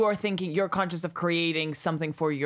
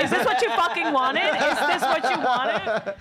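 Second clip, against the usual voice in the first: laughing mock yell; 7 semitones higher, 4 dB louder than usual. Mock outburst, laughing, high.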